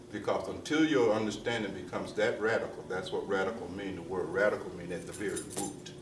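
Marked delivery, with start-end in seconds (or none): none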